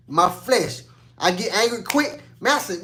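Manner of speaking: ordinary talk, nothing marked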